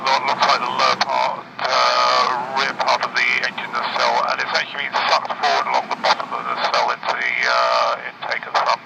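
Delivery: pilot radio. Real, not acted. Pilot describing smoke over radio.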